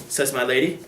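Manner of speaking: plain reading voice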